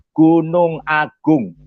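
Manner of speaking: slow, drawn-out answer; deliberate punchline. Retold or imitated. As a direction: stretch each word for the joke. Drawn-out punchline answering his own question. (jawaban lucu yang diulur pelan)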